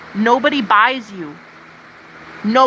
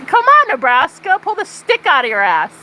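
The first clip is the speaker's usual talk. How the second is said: much higher, louder call-out; 8 semitones higher, 4 dB louder than usual. Real, not acted. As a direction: yell it at the whole state. Calling out Nebraska.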